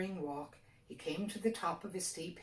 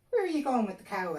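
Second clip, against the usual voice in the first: fairy stranger's question; 6 semitones higher, 8 dB louder, faster than usual. A narrator's reading. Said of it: "asked the stranger".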